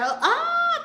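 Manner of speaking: very high, loud "ah"; delighted squeal. Real, not acted. Host about to thank a viewer, delighted squeal.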